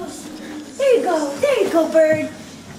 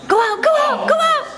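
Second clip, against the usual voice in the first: urging bird out; 11 semitones higher than usual. Real, not acted.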